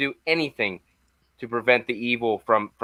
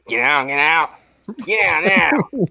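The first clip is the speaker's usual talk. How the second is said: louder, urgent mock warning cry; Loomis's famous warning; 5 semitones higher, 5 dB louder, slower than usual. Co-host quoting the doctor's famous last line, loud urgent imitation. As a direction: bark the warning like the doctor fleeing. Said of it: "obviously, the famous".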